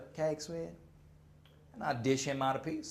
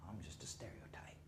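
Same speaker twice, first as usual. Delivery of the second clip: much softer, voiceless aside; whispered aside; 10 semitones lower, 20 dB quieter than usual. Real, not acted. To the audience about himself, a whispered aside. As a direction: breathe it like a secret.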